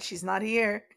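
ordinary talk, nothing marked

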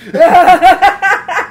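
loud high startled bah; shocked exclamation. Pretend. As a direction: burst out in shock. Imitates shocked eyes reaction.

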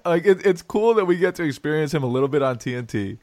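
ordinary talk

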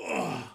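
low loud grunt; grunt of pain. Acted. GM voicing the player hit, loud low pained grunt. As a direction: take a blow.

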